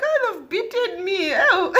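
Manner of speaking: high wailing complaint to mum; hammed-up tearful whine; mock crying. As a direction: wail it like a tearful child, half laughing. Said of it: "I'm crying".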